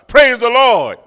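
loud imitated praise. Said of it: "she says".

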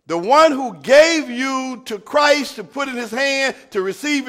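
loud, high sermon voice, slowing on key words; full-force preaching. Acted. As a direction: preach it to the back row.

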